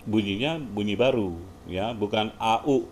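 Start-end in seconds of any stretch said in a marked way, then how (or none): none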